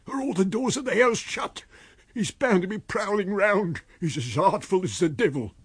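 anxious voice. A narrator's reading. Marvel anxious, peering out.

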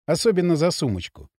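ordinary delivery, nothing marked

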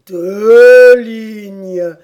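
loud, drawn-out interjection; emphatic correction. Acted. Turtle corrects the griffon, loud and emphatic. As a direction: cut in loudly and stretch the words.

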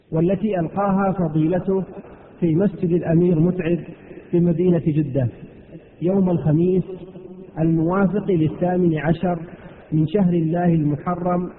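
ordinary talk

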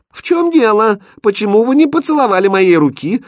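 high, loud indignant demand; angry. A narrator's reading. Princess demands why he did not kiss her hand, angrily. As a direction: raise pitch and volume in royal anger. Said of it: "гневно спросила принцесса".

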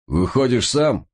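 медленный командный крик; командуй издалека, протяжно; громкий окрик. A narrator's reading. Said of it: "крикнул майор". (протяжный крик команды)

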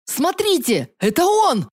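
high panicked yell; terrified shout. Acted. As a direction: scream a fake alarm. Fake terrified alarm.

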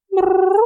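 high rolled brrr; surprised cat brrr. A narrator's reading. Startled cat flattening ears, high brrr. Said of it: "удивлённо прижала уши Васька".

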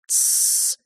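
soft, long shushing sound; hushing hiss. A narrator's reading. Brother silencing his brother, hushing hiss. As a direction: shush through barely moving lips. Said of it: "едва шевеля губами прошепел тот".

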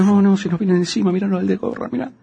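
urgent warning, eyes on the cops; panicked. Acted. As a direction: actor warning his partner about a cop. Panicking about approaching police.

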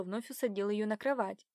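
plain reading voice

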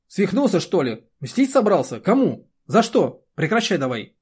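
loud, high volley of angry questions; angry scolding. A narrator's reading. Angry scolding after the bark.

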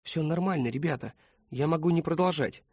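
smooth things over anxiously; placating. A narrator's reading. Christopher trying to settle the classroom conflict, placating. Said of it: "попытался уладить конфликт".

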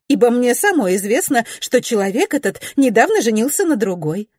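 high, excited gossiping lady; a high-pitched, eager young society lady. A narrator's reading. Narrator voices Mrs Glenarm.

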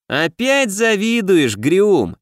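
high, slow teasing drawl; snide drawl. A narrator's reading. Clerk Gumli teases the guard, slow snide drawl. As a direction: stretch it out to needle him. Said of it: "ехидно протянул Гумли".

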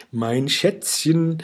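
slow sweet coo. A narrator's reading. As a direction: coo it like a sugary witch.

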